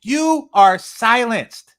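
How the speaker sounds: loud high cry, then slow heavy you are silenced; shouting. Real, not acted. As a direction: yell it out, then drag the last line.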